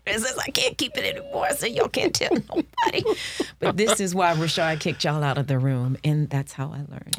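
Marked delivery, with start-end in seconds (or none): 0.1-3.0 giddy, pitched high; high, excited and unable to keep a secret. Retold or imitated. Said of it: "had to tell people".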